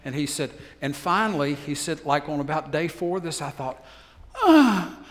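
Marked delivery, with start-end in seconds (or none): 4.4-5.0 exasperated groan. Retold or imitated. Fed up with endless questions.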